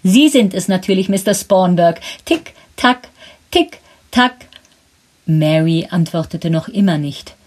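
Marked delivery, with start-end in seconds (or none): 0.0-4.5 mocking phone voice. Caller on the line teasing the silent Mary, singsong mockery. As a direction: taunt him in a singsong tick tack. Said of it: "vernahm sie ein Lachen".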